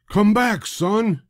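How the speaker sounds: loud, high call after his son; yelling. A narrator's reading. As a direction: call him back, alarmed. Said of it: "he yelled".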